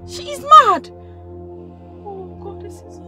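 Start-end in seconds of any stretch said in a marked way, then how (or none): 0.1-0.9 loud, horrified exclamation; shocked cry. Acted. Shocked onlooker cries out.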